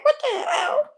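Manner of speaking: squeaky high laughing "what the hell"; as if catching herself nodding off; laughing disbelief. Retold or imitated. Realizing at the bus stop she's high, laughing in shock. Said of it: "I was like".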